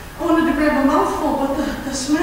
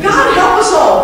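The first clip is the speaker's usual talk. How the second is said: louder, exasperated plea to heaven; exasperated outcry; 6 dB louder than usual. Acted. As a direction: cry it out, fed up.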